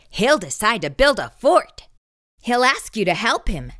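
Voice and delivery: ordinary delivery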